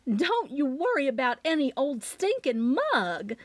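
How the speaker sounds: a touch of relieved laughter in a mother's voice; mother brushing it off, lightly amused; laughing mother. A narrator's reading. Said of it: "Mama laughed".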